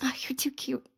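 soft whisper. Real, not acted. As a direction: whisper it tenderly. Whispered aside.

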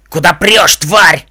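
loud, abusive yell; bullying stallion's roar; angry shout. A narrator's reading. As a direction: yell roughly to stop someone in their tracks. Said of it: "крикнул лощёный жеребец".

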